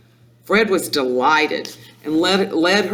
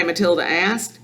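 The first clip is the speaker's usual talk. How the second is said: plain reading voice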